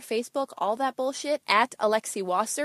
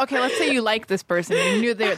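ordinary talk

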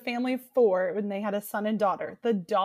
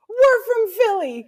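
high, louder, slow repeat; loud echo; 10 semitones higher, 9 dB louder, slower than usual. Retold or imitated. Next table shouts their hometown back, high and loud. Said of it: "the next table next to us goes".

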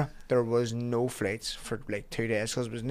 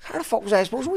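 mock defensive yell; 11 semitones higher, 4 dB louder, faster than usual. Retold own defensive shout.

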